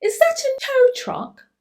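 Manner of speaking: high, loud amazed ask; surprised question. Acted. Boy spotting the tow truck, high surprised question. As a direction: wonder out loud at the machine.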